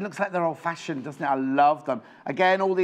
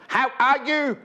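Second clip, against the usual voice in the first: high put-on comic greeting; comedy sketch impression; 8 semitones higher, 4 dB louder, slower than usual. Presenter imitating French and Saunders to a viewer, comic impression. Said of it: "That's my impression. It's from French and Saunders".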